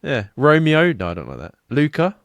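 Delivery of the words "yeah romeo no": ordinary delivery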